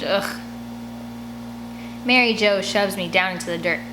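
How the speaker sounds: plain reading voice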